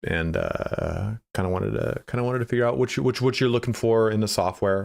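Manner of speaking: imitating a boss's relaxed, halting call; lightly voiced unhurried phone salesman; calm mentor's sales delivery. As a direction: ease into the point with casual pauses. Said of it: "that's exactly how he used to speak".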